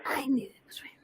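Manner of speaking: a quick breathy whisper to herself; whispered aside. Real, not acted. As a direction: mutter it privately under the breath.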